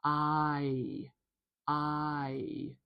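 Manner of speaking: "I" held long with both parts sounded; stretched, evenly gliding "I"; two-part diphthong. Shown as a demonstration. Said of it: "important to say them both".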